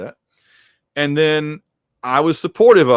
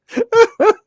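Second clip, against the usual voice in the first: high, loud burst of laughter; helpless high laugh; real laughter; 5 dB louder than usual. Real, not acted. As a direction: crack up at your own joke. Cracking up at own joke.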